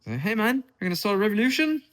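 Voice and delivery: high-pitched, badly attempted movie character accent; Grok impression. Imitating the rock guy from Thor Ragnarok, amateur accent attempt. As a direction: have a go at a high rock-creature voice. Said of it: "the rock guy".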